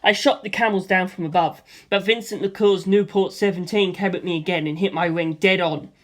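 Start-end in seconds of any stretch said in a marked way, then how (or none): none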